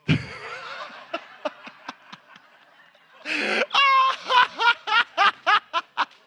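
high, loud burst of laughter at his own punchline; real helpless cackle, pitch flying up; can't hold it in, shrieking with laughter. Real, not acted. Pastor bursts out at own joke.

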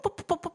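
quick, clipped pum pum pum of a racing heart; fast heartbeat. Shown as a demonstration. Preacher showing the congregation an untrained heart, quick pum pum. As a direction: tap out a hurried heartbeat with your voice. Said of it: "sondern es wird langsamer".

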